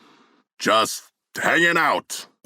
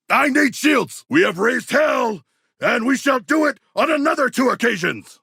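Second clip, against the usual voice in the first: frantic combat shout; 13 semitones higher than usual. Acted. King Shark shouting to his squad mid-battle, frantic yell. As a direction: yell over the fight noise.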